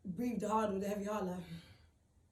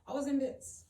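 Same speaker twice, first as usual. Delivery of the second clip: raised, higher-pitched admission; emotional emphasis; 5 semitones higher, 6 dB louder than usual. Real, not acted. Recalling the hug from a stranger, emotional emphasis. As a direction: push it out high and loud.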